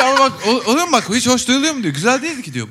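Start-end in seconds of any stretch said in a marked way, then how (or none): 0.0-1.8 high-pitched, insistent uncle voice; complaining old man. Retold or imitated. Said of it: "diyor ki yaşlı amca". 1.9-2.5 high-pitched, insistent uncle voice; complaining old man. Retold or imitated. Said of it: "diyor ki yaşlı amca".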